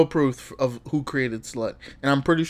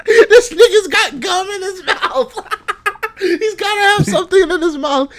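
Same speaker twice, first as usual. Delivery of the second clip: laughing; 6 dB louder than usual. Real, not acted. Cracking up at the clip.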